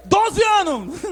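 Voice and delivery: high, forceful exclamation; blurted answer. Acted. Neemias finally answering the king, blurted loudly. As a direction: blurt it out loud at last.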